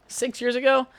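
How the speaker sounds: high, rising disbelief; can't believe it's been that long; surprised question. Real, not acted. To listeners, shocked at how long ago: high surprised question.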